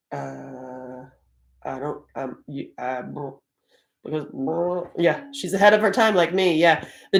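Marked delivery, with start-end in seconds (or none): 0.1-4.9 low, soft, halting mumble; acting lost for words; stumped stammering. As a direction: fumble quietly for an answer. Acts being stumped by child's question.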